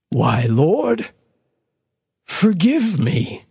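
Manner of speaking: slow, hushed prayer to the Lord; prayerful. A narrator's reading. Husband praying to God in remorse, prayerfully. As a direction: pray it, asking forgiveness. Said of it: "Mister Tetterby said prayerfully".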